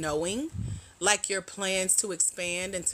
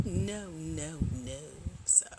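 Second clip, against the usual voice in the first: ordinary talk, nothing marked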